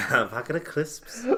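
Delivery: high mock Scouse; Liverpool accent. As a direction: echo the Liverpool accent imitation. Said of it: "with his Liverpool accent".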